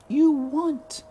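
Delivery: put-on mother asking her child to release her; dying mother.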